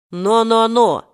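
wag a finger with your voice; sly, imposing warning. A narrator's reading. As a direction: chant it as a knowing caution. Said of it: "хитро прищурившись и внушительно помахивая".

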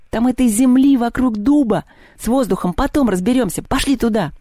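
worked-up, high-pitched exclamations; excited yelling. Acted. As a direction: shout out your breakthrough, correcting yourself eagerly. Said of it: "завопил он".

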